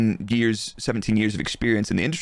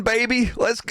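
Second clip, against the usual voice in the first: mock American hype; 11 semitones higher than usual.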